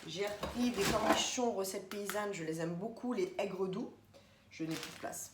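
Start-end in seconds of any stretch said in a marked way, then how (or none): 4.5-5.3 breathy whisper, under the breath. Shown as a demonstration. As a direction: murmure « Je n'ai plus de place » tout bas. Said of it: "répète autrement". (chuchoté)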